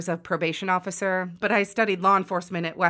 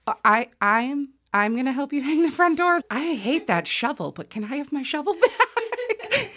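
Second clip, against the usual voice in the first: stammering, high-pitched incredulous question; comically panicked reluctance, voice climbing; flustered disbelief; 8 semitones higher than usual. Retold or imitated. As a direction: squeak up into a dismayed, laughing plea. Flustered reaction to unexpected door job.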